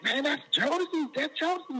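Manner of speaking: high-pitched excited exclamation; high, eager retold outburst; excited mistaken recognition. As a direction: imitate callers buzzing with recognition. Excited callers mistake the group.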